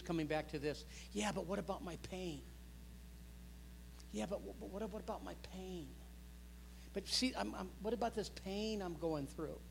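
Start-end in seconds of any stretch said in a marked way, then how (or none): 1.1-5.9 hurting churchgoer. Retold or imitated. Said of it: "people that are going through difficult times". 6.9-9.6 higher, softly imitated plea; hurting churchgoer. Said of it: "people that are going through difficult times".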